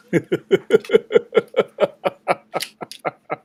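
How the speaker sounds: loud mocking evil laughter. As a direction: cackle like a gloating dark spirit. Villain laughs in taunt.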